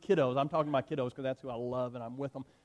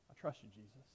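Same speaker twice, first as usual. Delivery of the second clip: quiet prayer; 5 semitones lower, 16 dB quieter than usual. Pretend. Voicing a simple prayer.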